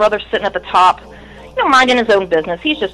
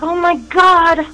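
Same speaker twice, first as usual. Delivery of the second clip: much higher, slow, drawn-out amazement; stunned disbelief; 10 semitones higher, much slower than usual. Retold or imitated. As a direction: stretch it out in shock. Hearing Psychopathic Records called.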